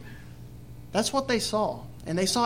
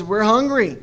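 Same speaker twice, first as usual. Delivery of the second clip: loud whining "We're hungry"; whiny complaint; 7 dB louder than usual. Retold or imitated. Israelites complaining about food.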